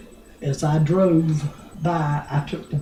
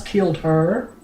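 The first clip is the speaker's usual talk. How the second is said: ordinary talk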